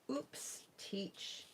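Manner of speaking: croaky voice breaking out; voice cracking. Real, not acted. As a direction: voice gives out mid-word. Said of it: "Excuse my croaky voice this morning".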